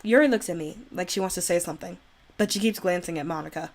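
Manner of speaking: normal talking voice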